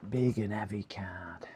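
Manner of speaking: breathy whisper to himself. Real, not acted. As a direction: whisper it under your breath. Muttered while lifting the card.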